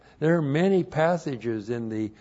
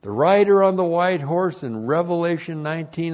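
loud, raised declamatory teaching voice; emphatic point read-out; 5 semitones higher, 8 dB louder than usual. Real, not acted. Preacher dictating a numbered point to the congregation, emphatic read-out. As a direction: announce it forcefully, word by measured word.

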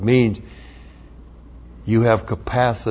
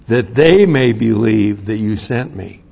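usual speaking voice

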